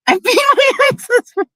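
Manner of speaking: high, squeaky laugh through the words; real laughing. Real, not acted. Woman admitting a fight with ex-husband, laughing. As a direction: confess it through helpless laughter.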